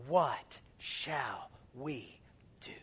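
slow whisper; whispered question. Acted. Pastor voicing the crowd's plea, whispered. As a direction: whisper What shall we do slowly.

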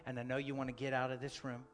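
soft, low reassurance; tender fatherly voice. Retold or imitated. God comforting his hurting child, soft and tender. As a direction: speak gently as a loving father.

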